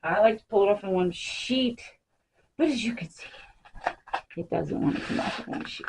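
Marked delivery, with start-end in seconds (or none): 2.6-5.9 hushed aside, barely voiced; whisper. Real, not acted. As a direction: whisper it under your breath while working. Whispered aside while working.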